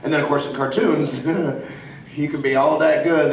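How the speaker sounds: usual speaking voice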